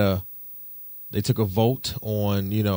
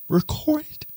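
whisper. Retold or imitated. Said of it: "whispered aside".

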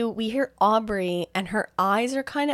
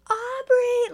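calling out. Retold or imitated. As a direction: yell the name to find her. Friends calling out her name.

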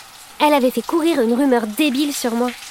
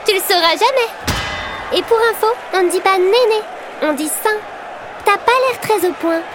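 cutting comeback; 6 semitones higher than usual. Acted. Scoring comeback in verbal duel.

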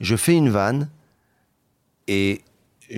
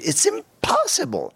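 "impossible" with POS stretched out very high; slow, high-pitched punch on the POS of "impossible"; exaggerated tonic stress; slower than usual. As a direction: squeal the stressed POS in "impossible", then drop. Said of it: "Accent tonique".

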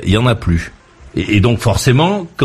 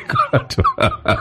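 laughing; 4 dB quieter, slower than usual. Real, not acted. Laughing at farmers milking by hand.

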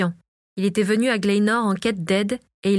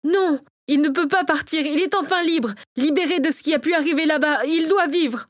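much higher, impassioned protest; fervent plea; 7 semitones higher than usual. A narrator's reading. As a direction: plead for his life with urgent fervour. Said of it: "La ferveur qu'il y avait dans les paroles".

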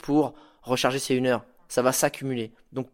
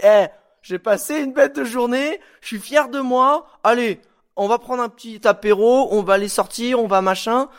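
plus aigu et plus fort, tout content de soi; s'affaler ravi après une grosse journée; fierté joyeuse; 7 semitones higher, 5 dB louder than usual. Pretend. Fier de sa journée, sur le canapé. (la voix de quelqu'un qui a gagné sa journée, aiguë et forte)

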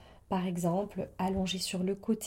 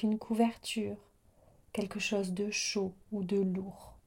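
guided meditation. Real, not acted. Yoga teacher easing listeners into yoga nidra, guided meditation voice. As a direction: settle the listener gently, phrase by phrase.

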